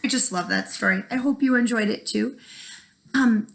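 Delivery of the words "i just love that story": plain reading voice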